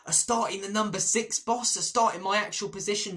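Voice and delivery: hammed-up eager kid asking his boss; excited young player. Archie begging the manager for a start, mock excited. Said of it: "He's gonna go".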